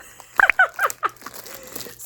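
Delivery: big burst of high laughter; loud high laugh. Real, not acted. Laughs at unpronounceable name.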